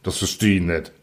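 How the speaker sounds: low, grumbled aside; grumbling. A narrator's reading. Secretary admits he doesn't understand the youth, low grumble. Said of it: "brummte sein Begleiter".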